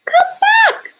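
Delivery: high desperate shout after the dogs. A narrator's reading. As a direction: call out loud and high as they drift away. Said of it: "Aluki shouted".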